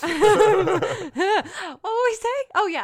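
high, very slow laughing question. Real, not acted. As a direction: laugh it out, then ask. Laughing after the fly.

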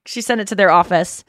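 ordinary delivery

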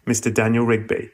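ordinary talk

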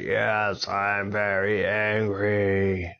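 slow, stretched-out dragon roar; angry dragon. Acted. Dragon admits his anger to Avon, drawn out. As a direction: hold each word long in mock rage. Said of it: "I could see how angry you are".

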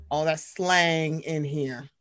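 ordinary delivery

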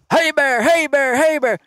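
high, repeated mock holler; retold yelling. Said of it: "we're yelling at the grizzly bear".

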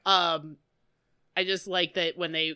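ordinary talk, nothing marked

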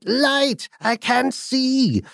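high, drawn-out ghostly cry; shouting goblin voice. Retold or imitated. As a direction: call out like a spirit lost in the dark. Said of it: "the voice shouted".

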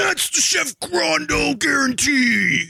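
slow, high shouted heel catchphrase; wrestling promo. Pretend. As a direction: drag out the signature line with a yell. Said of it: "I need you to make a wrestling promo now".

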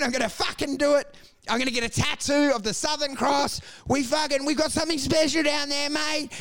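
high, boastful mock bogan rant; drunk Aussie patriot. Tipsy expat boasting about Australia, high hammed-up pride. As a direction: gush it like a tipsy proud expat. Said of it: "you end up going".

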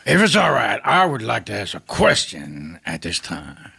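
impersonated movie tough guy; Robert Loggia. Acted. Impressionist addresses the escape room host, in an actor's voice. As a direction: ask formally in a celebrity impression. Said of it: "sounds just like Robert Loggia".

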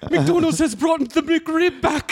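high, grand proclamation of a burger monarch; a hammed-up knight rallying the troops for war; mock royal herald. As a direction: cry it out like a medieval herald, hammed-up. Mock king's herald and war cry.

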